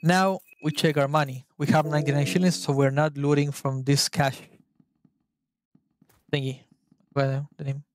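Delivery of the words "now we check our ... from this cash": ordinary talk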